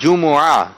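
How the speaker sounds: جمعہ in three clear syllables, ju-mu-aah; correct pronunciation. Shown as a demonstration. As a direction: teach جمعہ with a u after the m and an open long ending. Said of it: "تو یہ اس کا درست تلفظ ہے".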